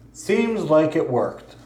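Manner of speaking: normal talking voice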